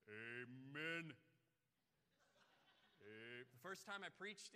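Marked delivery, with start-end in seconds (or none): 0.1-1.2 low, loud, booming amen. Retold or imitated. Imitating the Mississippi man's booming amen. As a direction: boom it out like a big man. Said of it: "this booming voice".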